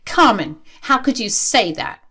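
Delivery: gasped-at, wounded indignation at a daughter; appalled parent. Acted. Parent shocked by daughter's remark.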